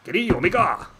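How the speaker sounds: mock Japanese-accented "Kenny Omega!". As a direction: imitate a Japanese announcer, stressing "Kenny" with a falling call. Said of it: "if you do an accent".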